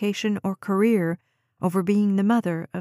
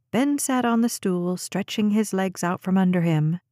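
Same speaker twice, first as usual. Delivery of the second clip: usual speaking voice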